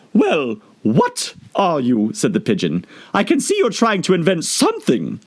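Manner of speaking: doubting, pressing her; suspicious pigeon. Acted. Pigeon questioning Alice, suspicious.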